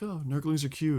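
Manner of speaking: mock cooing. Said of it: "back to being like".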